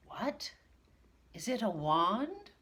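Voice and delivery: high, startled question; astonishment. Acted. Teacher astonished to learn about the wand. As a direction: gasp it out in surprise. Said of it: "cried Mister Grimm in astonishment".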